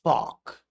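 Fuck with an open ah, fahk; Alfie's fuck. Shown as a demonstration. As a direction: Fuck said fahk, London style. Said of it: "Alfie Solomon's".

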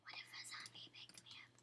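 fast breathy whisper. Pretend. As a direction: whisper it quickly. Voiced item whispering.

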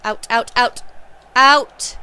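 will the ball over the line; building urgent call. Real, not acted.

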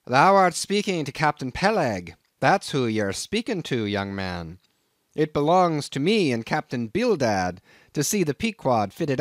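crusty Nantucket captain sizing up a greenhorn; gruff old sea captain. Acted. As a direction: bristle at the stranger like a crusty old skipper. Captain introduces himself.

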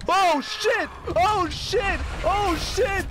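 screaming in panic, very high; shrieking jackpot winner. Real, not acted. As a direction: shriek it over and over. Jump scare in game.

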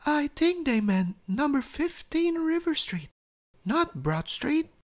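high-pitched storybook mum; mother's voice. A narrator's reading. Mummy explains the wrong address to Daddy, high mother's voice. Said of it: "said Mummy to Daddy".

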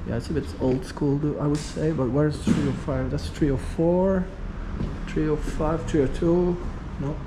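ordinary talk, nothing marked

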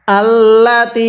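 slow, drawn-out recitation; recitation demo. Shown as a demonstration. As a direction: draw the word out as a model for students. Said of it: "আমার সাথে পড়ুন।".

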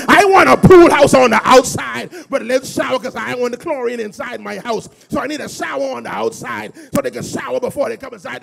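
high, shouted preaching easing at the end; full-force preaching. Real, not acted. Preacher asking God for the whole thing, high shouted preaching. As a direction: shout out the requests like a fired-up preacher.

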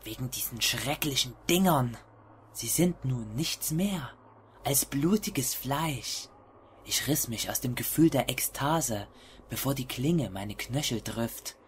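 low, hushed horror story narration; hushed creeping horror. A narrator's reading. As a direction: read it like a creeping, dark confession. (leise, schleichende Horror-Erzählung)

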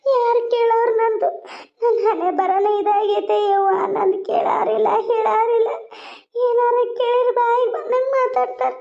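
high, drawn-out mock wail; fake crying. Mother-in-law staging tears for her son, fake crying. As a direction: put on a tearful, self-pitying lament. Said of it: "ನಾನ್ ನಾಟಕ ಮಾಡ್ತಾನ".

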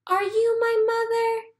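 high, small pleading chick voice; a tiny lost bird asking; baby bird voice. A narrator's reading. Baby bird asks the kitten if it's his mother, high and small. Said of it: "he said to the hen".

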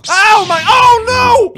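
loud, high cry of alarm; shocked shout. Real, not acted. Gamer watching a player slam a man into a roof, shocked shout. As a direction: yell it in disbelief.

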